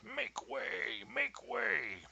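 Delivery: high, drawn-out crowd-clearing bellow. A narrator's reading. Roman guard clearing a path for a guest, bellowing. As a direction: call it out high and long to part a crowd. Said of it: "as he bellowed".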